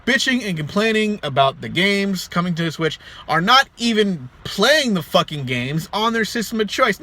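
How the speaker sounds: ordinary talk, nothing marked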